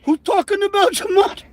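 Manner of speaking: high shouting caricature; hammed-up screeching rant; shouted mock impression. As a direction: yell it like a frantic crank. Mocking the gematria guy.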